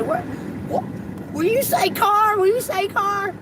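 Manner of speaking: very high, incredulous mock customer voice; imitated baffled customers. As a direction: imitate callers amazed by an accent, way up high. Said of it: "These motherfuckers say".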